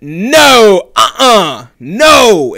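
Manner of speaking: loud, high, drawn-out objection; retold protective shout; forceful refusal. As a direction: shut it down hard, each no ringing out. Retold friend stepping in between them.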